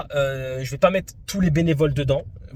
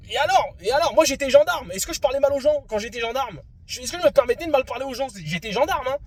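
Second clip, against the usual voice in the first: indignant rant; 8 semitones higher than usual. Real, not acted.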